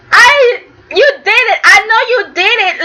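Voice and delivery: high, crying shout; mock furious accusation. Nikki accusing Victor of the arrest, crying angry shout. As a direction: accuse him loudly, near tears. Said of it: "Nikki was pissed off".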